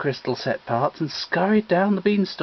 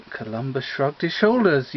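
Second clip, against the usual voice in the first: usual speaking voice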